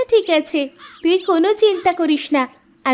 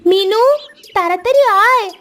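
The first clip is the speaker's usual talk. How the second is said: raised, higher call to a friend; 5 semitones higher, 3 dB louder than usual. Acted. Friend summons Minu to come quickly, calling out. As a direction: call her over urgently. Calls friend to hurry over.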